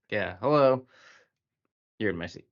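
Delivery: sharp "hello", then a dropped "You're in my seat"; imitating the businessman's passive-aggressive greeting; mock curt Frenchman. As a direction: needle them about the seat. Mimicking the curt French man.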